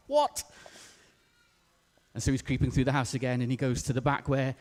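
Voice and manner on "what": high-pitched startled "what"; startled burglar. Acted. Burglar hears a voice in the dark, startled. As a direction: jump in pitch, caught off guard. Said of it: "He's like".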